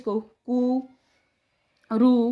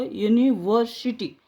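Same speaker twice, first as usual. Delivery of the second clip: slow demo; slower than usual. Shown as a demonstration. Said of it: "तीन, अपने तीन टुकड़े हुए हैं".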